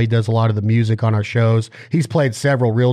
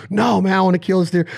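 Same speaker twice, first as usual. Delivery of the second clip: frustrated hunter; 7 semitones higher than usual. Retold or imitated. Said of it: "'cause you're like".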